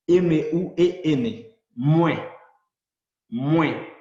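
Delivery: letter by letter, then "Muen" loud; spelling and pronouncing. Shown as a demonstration. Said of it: "W en criollo es como la U en castellano". (deletrear y decir "Muen" como mwe)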